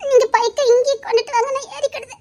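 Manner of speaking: high tearful whimper; crying. Acted. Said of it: "சரி, அழாத".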